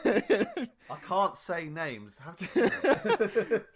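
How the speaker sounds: high, loud, slow words through laughter; helpless laughter. Real, not acted. Podcaster admitting a botched name, helpless laughter. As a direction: laugh hard while owning up.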